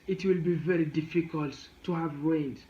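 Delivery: usual speaking voice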